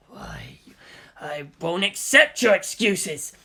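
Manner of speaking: hissed start, then slow and heavy; angry outburst. Acted. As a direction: bite out each word in anger. Toji furious at Shinji.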